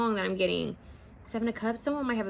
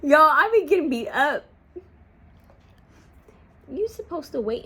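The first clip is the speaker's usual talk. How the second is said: laughing complaint; 7 semitones higher, 7 dB louder than usual. Real, not acted. Complaining after dog hit.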